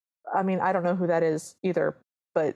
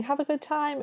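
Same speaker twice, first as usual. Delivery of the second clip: calling out; 8 semitones higher than usual. Retold or imitated. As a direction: echo her call in a raised pitch. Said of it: "Cinderella calls".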